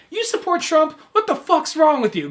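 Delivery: high, loud mock outrage; incredulous friends. Friends scolding the Trump supporter, high and loud. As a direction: squeal it in disbelief. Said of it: "everyone's like".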